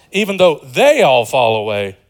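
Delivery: loud protest with stress on "they"; indignant disciple's objection. Retold or imitated. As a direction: protest firmly, punching "they all". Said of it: "Peter protests once again".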